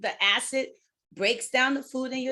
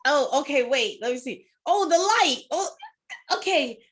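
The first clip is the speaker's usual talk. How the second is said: raised, loud, flustered fumbling; flustered driver; 3 semitones higher, 6 dB louder than usual. Pretend. A driver eating in the car, mock fluster. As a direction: act out juggling food at a red light.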